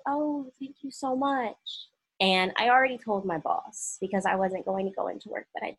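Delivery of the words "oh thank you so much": high-pitched retold thank-you; polite put-on thanks. Employee thanking her district manager, politely put-on. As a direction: raise the pitch for a polite front.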